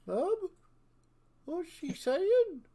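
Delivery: very high, plaintive little one's voice; small, high creature voice; young dragon child. Acted. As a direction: pipe it up like a puzzled youngster asking mum. Young dragon asks its mother.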